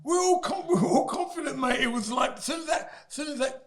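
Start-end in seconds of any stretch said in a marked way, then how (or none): 0.0-1.8 high, laughing impression of the cocky camp; mock camp bravado. Boxer mocking his camp's confidence before the fight. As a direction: ham up the team's false confidence.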